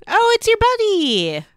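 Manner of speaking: high, loud teasing squeal; delighted exclamation. Real, not acted. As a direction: exclaim it with gleeful recognition. Teasing a friend about his buddy.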